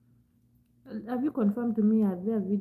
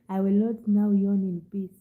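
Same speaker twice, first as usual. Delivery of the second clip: ordinary talk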